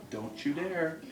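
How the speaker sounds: calm hushed warning to the lynx; quiet warning. Real, not acted. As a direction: murmur dare as a quiet real warning. Warning the lynx near the wall.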